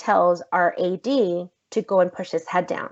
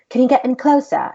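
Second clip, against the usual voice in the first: louder, higher mock British voice; bad British accent; 6 semitones higher, 5 dB louder, faster than usual. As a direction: badly imitate a British director. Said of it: "very horrible job at a British accent".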